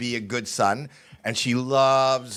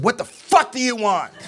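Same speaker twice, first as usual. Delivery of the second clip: demented mom's furious yell; 9 semitones higher than usual. Retold or imitated. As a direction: bark it at your son like an angry old mom. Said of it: "She said".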